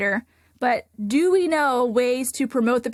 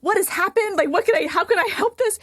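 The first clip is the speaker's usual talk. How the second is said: inner alarm; 8 semitones higher than usual. Retold or imitated. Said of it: "I'm thinking".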